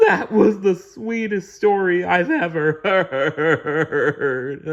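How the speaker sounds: high, slow, blubbering through tears; sobbing laugh-cry. A narrator's reading. Genie bawling over Jasmine's love story, sobbing. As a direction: bawl it out like a big baby. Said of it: "the genie said between sobs".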